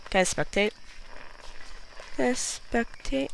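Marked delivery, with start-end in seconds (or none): 2.1-3.3 higher, slow stretched-out wording; drawn-out question. Pretend. Dead player asking to spectate, drawn-out question. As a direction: stretch "spectate" out in a playful lilt.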